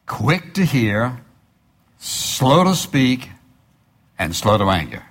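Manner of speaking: each phrase drawn out, pausing between them; slow deliberate scripture reading. Acted. Preacher to congregation, slow emphatic reading. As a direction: lay out quick to hear, slow to speak slowly.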